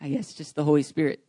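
tearful laugh, still choked up; emotional. Real, not acted. Pastor recovering before his congregation, tearful. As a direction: laugh through the tears. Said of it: "I'm getting emotional".

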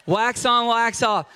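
wax on, wax off with a laugh. Shown as a demonstration. Preacher repeating the movie line, laughing. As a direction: chuckle through the line. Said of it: "can you read that for me".

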